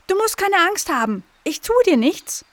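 high, eager little dog voice; young dog calling reassurance; dog reassuring. A narrator's reading. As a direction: coax the scared animal gently but eagerly. Dog reassures scared animal.

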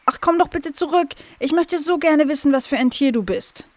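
put-on high, plaintive young dog voice; dog pleading after the squirrel. A narrator's reading. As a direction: beg it to come back. Dog pleads animal to return.

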